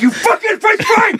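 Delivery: high-pitched mock furious shout; enraged dad. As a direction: scream it at the gull, hammed up. Acted dad attacking seagull.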